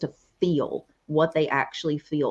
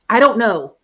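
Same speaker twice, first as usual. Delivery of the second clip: exasperated reply; 4 semitones higher, 10 dB louder than usual. Retold or imitated. Husband's reply retold.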